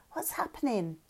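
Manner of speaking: put-on a scared child asking, voice sinking softly; whimpering. A narrator's reading. Madsen asks Lauren on the crashed boat, softly frightened.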